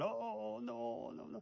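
high, soft drawn-out "oh no"; mock group dismay. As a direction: imitate onlookers wailing softly in dread. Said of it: "Everybody's going".

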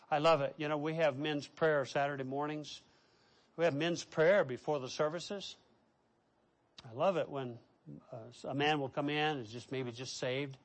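ordinary delivery

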